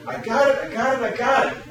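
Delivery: high shouted I got it; mock outfielder call; calling for the ball. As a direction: yell it out to claim the catch.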